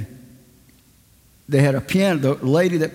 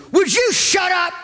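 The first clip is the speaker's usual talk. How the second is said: exasperated shout. Retold or imitated. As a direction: blurt it out, patience gone. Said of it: "he finally went".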